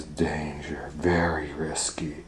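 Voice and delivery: whispered warning; hushed warning sign. A narrator's reading. Forest sign warning Goldilocks, whispered. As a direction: breathe the danger like a secret. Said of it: "it says".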